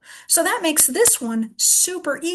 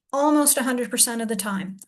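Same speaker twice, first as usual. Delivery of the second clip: ordinary talk, nothing marked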